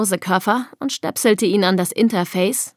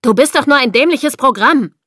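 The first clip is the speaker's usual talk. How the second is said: louder, higher scornful outburst; angry yell; 5 semitones higher, 5 dB louder than usual. A narrator's reading. Ed insults his program, angry yelling. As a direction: shout it contemptuously. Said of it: "brüllte Ed zu dem Lautsprecher".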